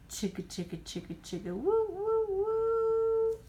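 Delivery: choo-choo train sound, chug then whistle; train chugging and whistle. A narrator's reading.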